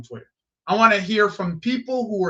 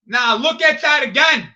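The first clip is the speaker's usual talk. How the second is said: loud, raised call to watch the replay; indignant shout; 3 semitones higher, 5 dB louder than usual. Real, not acted. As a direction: demand everyone look at the replay. Replay of the foul.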